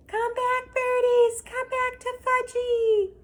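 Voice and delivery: put-on high, loud toddler wail; screaming. Toddler screaming after the pigeons, high and loud. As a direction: cry after the birds like a small child. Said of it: "was screaming".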